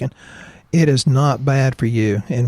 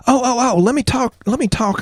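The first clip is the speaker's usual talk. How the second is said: excited; 11 semitones higher than usual. Real, not acted. Excited sudden idea.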